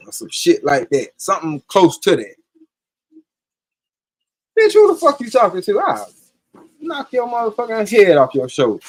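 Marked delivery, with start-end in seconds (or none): 4.6-8.8 playful fake tough-guy threat, pitched up high; hamming up a threat to his girlfriend, in jest; joking mock threat. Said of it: "so I'm saying it in jest".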